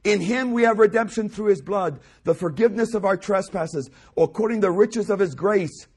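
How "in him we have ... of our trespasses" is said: ordinary delivery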